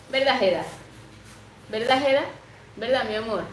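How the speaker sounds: sweet pet talk. Real, not acted. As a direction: coo at Jeda the pet. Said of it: "Yo le hablo así".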